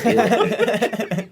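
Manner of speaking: high, slow, laughing shrug; nonchalant. Retold or imitated. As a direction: toss it off with a laugh. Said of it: "You're like".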